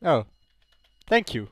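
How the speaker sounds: ordinary delivery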